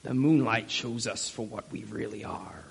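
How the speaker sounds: put-on low pirate-chief accent. Preacher quoting the chief of the pirates to the congregation, pirate accent. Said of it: "Maybe I'll do the accent this week".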